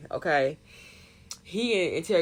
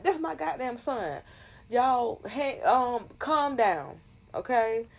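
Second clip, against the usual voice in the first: 1 cop dad pleading; 7 semitones higher, slower than usual. Retold or imitated. Cop dad shielding son, voiced.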